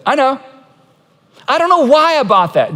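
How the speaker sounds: mild mock exasperation; exasperated confession. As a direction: sheepishly admit the bad purchase. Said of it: "Jeremiah says".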